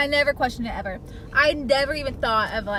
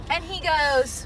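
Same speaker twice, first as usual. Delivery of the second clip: ordinary talk, nothing marked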